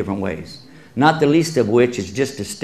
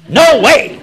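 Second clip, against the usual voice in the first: indignant outburst; 12 semitones higher, 7 dB louder than usual. Retold or imitated. Woman rejects a second husband.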